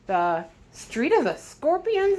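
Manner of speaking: high, slow, stunned repeat; shocked to hear the place name; gasped. A narrator's reading. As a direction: gasp it out in shock. Gozan echoes in shock.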